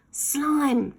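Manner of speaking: high call upward; shout. A narrator's reading. Ned falling and calling up to Slime, a shout. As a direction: call up into the sky for help. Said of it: "he shouted up".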